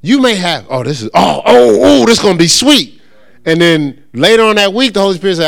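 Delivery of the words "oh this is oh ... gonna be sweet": loud, rising thrill at what's coming; excited anticipation. Acted. Teacher acting out delight at a fresh revelation, excited. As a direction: burst out with gleeful oohs.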